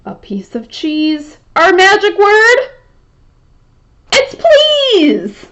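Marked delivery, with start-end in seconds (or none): 1.6-5.5 much higher, louder magician's announcement; grand reveal. Acted. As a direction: unveil it like a magic trick. Big reveal of the magic word.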